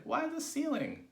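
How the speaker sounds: high, drawn-out puzzled question; incredulous cry. A narrator's reading. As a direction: question it slowly in disbelief. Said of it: "they cried".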